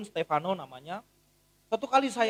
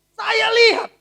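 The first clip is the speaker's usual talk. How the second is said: high, loud eager watching; loud wide-eyed saya lihat; excited; 13 semitones higher, 15 dB louder, slower than usual. Real, not acted. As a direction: lean in, thrilled to see.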